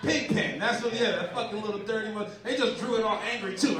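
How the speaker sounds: usual speaking voice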